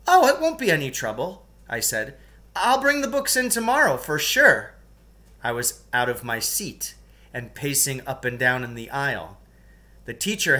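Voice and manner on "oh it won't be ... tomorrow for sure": mock helpful. Pupil cheerfully insists.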